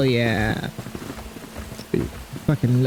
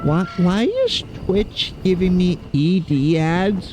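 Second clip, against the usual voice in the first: incredulous; 6 semitones higher than usual. Real, not acted. Incredulous at ads.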